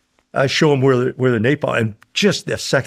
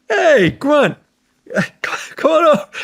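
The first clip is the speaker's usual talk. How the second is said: calling out; 13 semitones higher, much slower than usual. Retold or imitated. Soldier calls him from bunker.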